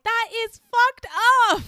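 a very high, loud, slow shocked cry; shocked outcry. Real, not acted. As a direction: cry out in disbelief. Reacting to a cruel confession.

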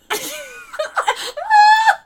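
high, loud burst of helpless laughter; shrieking laughter. Real, not acted. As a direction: explode laughing in a high squeal. Dog farts loudly mid-recap.

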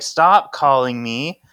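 acted irritation, leaning hard on the words; mad. Coop tells the other to stop, mildly mad. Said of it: "And then Coop is mad".

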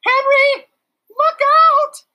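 high, loud scream of alarm; screamed warning. A narrator's reading. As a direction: shriek it out as the teacher. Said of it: "screamed Miss Roop".

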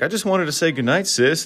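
lightly voiced cheeky brother; mock-innocent brother. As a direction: act sweet to cover a prank. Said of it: "he's just like".

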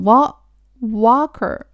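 "walker" as "wah-ker", broken off then said slowly; American pronunciation. Shown as a demonstration. Teacher dwelling on the American word, American pronunciation. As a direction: draw out "walker" with an American open ah. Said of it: "アメリカ英語のね".

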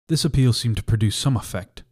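plain reading voice

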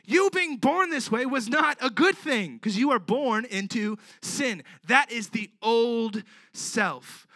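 loud, high-pitched preaching; preaching at full force. Acted. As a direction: preach it out to the room. Preaching to the congregation.

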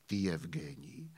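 deep quiet echo of the verse; low, soft answer line. Acted. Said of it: "básní".

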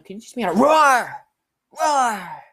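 high, loud animal roar; mock roar; roaring furry. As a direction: roar at her like a beast.

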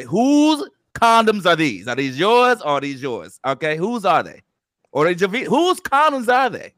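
playful host interrogating the staff about the condoms; mock interrogation. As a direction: grill everyone like a hammed-up suspicious host. Mock grilling the staff meeting.

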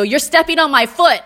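mock complaint. To Gary Oldman about his foot, loud high complaint. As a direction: tell him off, loud and high-pitched.

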